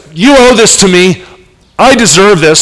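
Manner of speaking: a hint of a spoiled claimant; mock entitled insistence; entitled demand. Entitled demand the woman avoids.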